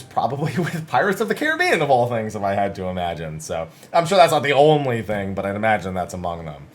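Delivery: usual speaking voice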